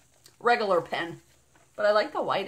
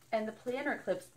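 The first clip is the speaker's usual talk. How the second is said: usual speaking voice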